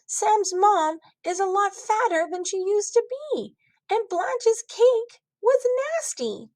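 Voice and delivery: put-on high, rising kid insistence; a kid sure he's right; indignant child. Child defending himself to parents.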